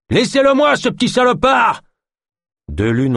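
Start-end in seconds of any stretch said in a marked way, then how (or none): 0.1-1.8 high, loud shout claiming the thief; furious shout. Acted. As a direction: shout the gang away from him. Dark arrive furieux. (cri furieux, très aigu)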